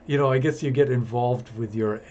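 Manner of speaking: ordinary delivery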